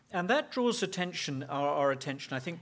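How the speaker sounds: usual speaking voice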